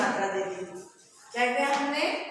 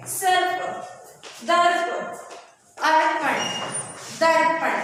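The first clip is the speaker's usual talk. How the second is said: each word called out higher and louder; loud model reading; 4 semitones higher, 4 dB louder, slower than usual. Shown as a demonstration. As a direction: read the words out loud for the class to repeat. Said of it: "तो हम इसको पढ़ेंगे". (कक्षा के लिए ऊँचे स्वर में शब्द पढ़ना)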